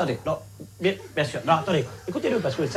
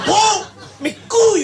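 slow, high, loud furious yell; the motto shouted in rage, syllable by syllable; screamed motto; 9 dB louder, slower than usual. Acted. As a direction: bellow the motto, out of control.

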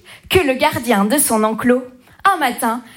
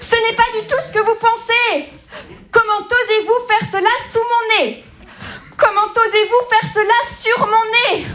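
outraged lion; 6 semitones higher than usual. Acted.

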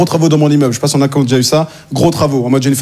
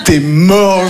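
ordinary delivery

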